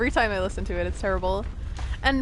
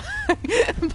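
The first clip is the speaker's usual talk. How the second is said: laughing. Real, not acted. Laughs at own joke.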